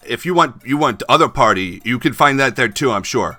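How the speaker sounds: put-on loud Chinese-accented cabbie, settling toward the end; choppy Chinese-accented sales talk; Han. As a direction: start high and loud, ease off, keeping the Chinese accent. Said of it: "accented with Chinese".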